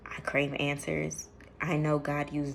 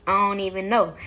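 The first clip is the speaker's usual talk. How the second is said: louder, loose and easygoing; casual slang voice; 2 semitones higher, 9 dB louder than usual. Shown as a demonstration. Showing viewers how she really talks, loud and casual. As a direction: let the proper voice drop into relaxed slang. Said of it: "I don't really talk like this".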